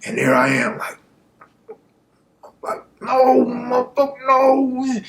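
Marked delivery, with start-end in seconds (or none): 3.0-5.0 high, strained, near-crying no; desperate pleading. Retold or imitated. Suspect silently begging the witness not to name him, near tears. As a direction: plead without sound, face begging.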